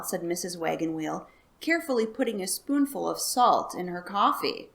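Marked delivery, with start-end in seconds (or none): none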